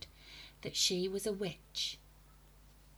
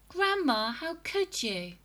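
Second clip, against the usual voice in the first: put-on disgusted boy; 10 semitones higher, 5 dB louder than usual. A narrator's reading.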